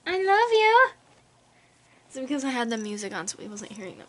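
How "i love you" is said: put-on much higher, louder cooing baby voice; baby talk to pet. Owner tells her cat she loves him, in high baby talk. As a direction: squeal the love to the cat like to a baby.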